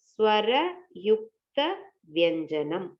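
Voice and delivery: ஸ்வர, யுக்த, வியஞ்சனானி said as separate chunks with pauses; syllable by syllable. Shown as a demonstration. Teacher showing students the term, syllable by syllable. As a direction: break ஸ்வர யுக்த வியஞ்சனானி into clear pieces. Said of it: "நம்மளுக்கு கிடைக்கிறது".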